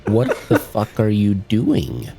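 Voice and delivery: normal talking voice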